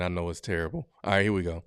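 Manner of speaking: ordinary delivery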